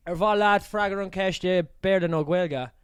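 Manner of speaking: put-on Irish spoken in a broad Aussie accent, loud; Irish words in full Aussie accent, a bit slow. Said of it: "He said in an Aussie accent, like, full Aussie accent".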